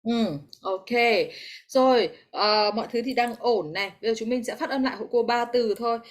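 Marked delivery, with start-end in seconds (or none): none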